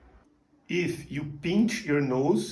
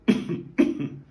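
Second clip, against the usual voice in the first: closed-mouth cough. Shown as a demonstration. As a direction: cough with lips shut. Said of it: "train by coughing with your mouth closed".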